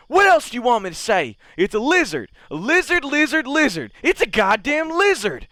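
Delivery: high, loud, fast panicked shouting; frantic drunk yelling. Acted. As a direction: scream it like a drunk who saw a monster. Drunk man insists it's a lizard.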